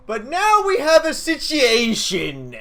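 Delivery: slow, loud and high, drawn out; dramatic announcement. Real, not acted. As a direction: announce it like big trouble.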